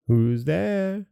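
ordinary delivery, nothing marked

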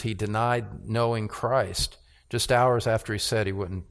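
normal talking voice

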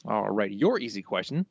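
normal talking voice

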